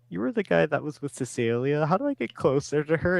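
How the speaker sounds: high, loud, urgent questioning; acted-out pushy, high-pitched questioning; eager questioning. Acting hero's eager questioning.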